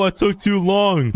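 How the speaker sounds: high, drawn-out whine; high whiny groan at a failed try; whiny despair. Real, not acted. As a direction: moan it like you just lost everything. Failed game attempt, whining.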